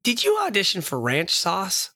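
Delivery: ordinary talk, nothing marked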